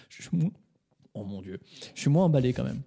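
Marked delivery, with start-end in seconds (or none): 0.1-1.6 choking after swallowing wrong; coughing through the words; choking on a drink. Real, not acted. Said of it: "J'ai failli m'étouffer".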